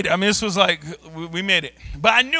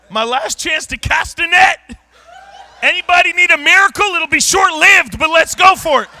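loud, higher urgent call for miracles; frantic altar call; 7 semitones higher, 6 dB louder than usual. Retold or imitated. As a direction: preach it like the last chance. Last-chance altar call on the plane.

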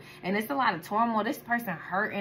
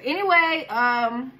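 loud reset; 4 semitones higher, 7 dB louder, slower than usual. Real, not acted. Snapping back to reading.

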